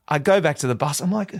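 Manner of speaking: normal talking voice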